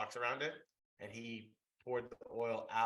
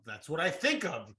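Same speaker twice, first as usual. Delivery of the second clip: testy, raised-pitch retort with punched words; irritated, emphatic self-defence; snippy; 6 semitones higher, 6 dB louder than usual. Real, not acted. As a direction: push back with attitude, louder and higher. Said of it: "Does he have an attitude right now?".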